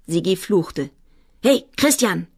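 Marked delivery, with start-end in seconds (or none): 1.4-2.3 higher, urgent shout for attention; raised calling voice; called out. A narrator's reading. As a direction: call to a friend sharply. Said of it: "rief er".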